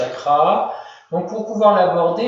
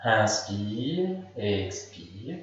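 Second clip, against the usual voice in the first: slow, softer guided breath cue; 8 semitones lower, 10 dB quieter, much slower than usual. Shown as a demonstration. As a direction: breathe the words out slowly and softly. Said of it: "guides breath in a relaxation".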